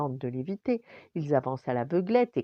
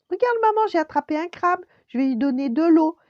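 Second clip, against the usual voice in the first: put-on high, loud child voice; thrilled kid shouting; excited little boy; 13 semitones higher, 10 dB louder, faster than usual. A narrator's reading. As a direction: call out to mum in high-pitched excitement. Excited boy calls his mother.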